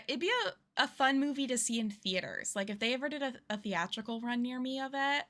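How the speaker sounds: ordinary talk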